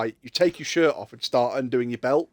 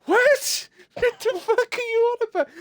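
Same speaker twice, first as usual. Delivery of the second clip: much higher, incredulous yelp; mock bewildered viewers; slower than usual. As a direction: play the baffled chat, pitched way up. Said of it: "people going".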